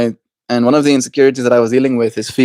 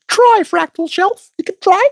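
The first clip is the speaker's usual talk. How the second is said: mock taunt. Teasing high voice at shadow self.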